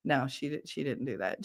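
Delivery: usual speaking voice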